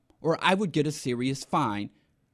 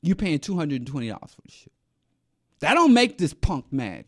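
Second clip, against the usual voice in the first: ordinary talk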